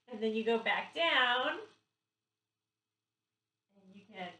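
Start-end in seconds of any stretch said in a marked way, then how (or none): none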